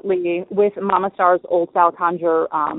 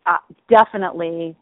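normal talking voice

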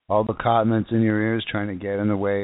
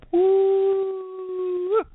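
awed whoop. Real, not acted. Telling viewers about nearby lightning, awed whoop. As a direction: let out a whooping woo.

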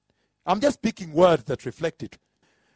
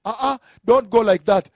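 loud, raised objection; acted protest; 6 semitones higher, 7 dB louder than usual. Slapped preacher standing up to the passenger, loud protest. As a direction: cut in with a loud mock protest.

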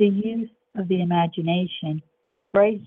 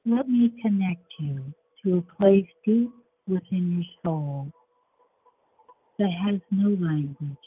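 guided meditation; 5 dB quieter than usual. Acted.

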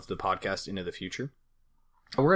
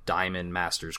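ordinary delivery

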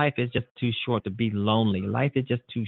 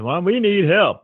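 a pitched-up, louder whiny plea from a scammer; mock needy con artist; 8 semitones higher, 7 dB louder than usual. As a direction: put on the fake pleading voice of a con artist asking for help. Host voices a con artist begging.

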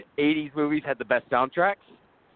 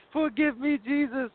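high-pitched mock plea; 12 semitones higher than usual. Mock plea, much higher.

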